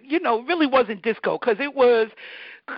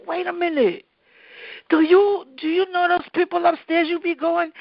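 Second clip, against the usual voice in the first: put-on high, eager little girl voice; excited kid; 7 semitones higher than usual. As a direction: burst out with sudden discovery. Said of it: "So I was like".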